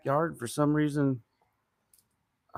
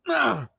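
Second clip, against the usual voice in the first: pained cry; 8 semitones higher, 4 dB louder than usual. Real, not acted. Strain from pulling clamp.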